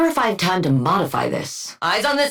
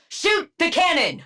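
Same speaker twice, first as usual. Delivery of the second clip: urgent command; 9 semitones higher than usual. Acted. Battle command.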